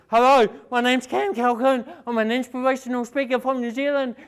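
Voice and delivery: fast eager phone pitch; too fast. Retold or imitated. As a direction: gabble the intro at top speed like a nervous caller. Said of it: "You speak fast".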